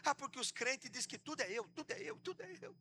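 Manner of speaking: whimpering devil, climbing high and fading soft; hammed-up tearful complaint; mock tearful devil. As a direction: play a hard-done-by devil crying. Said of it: "Por que você tá chorando?".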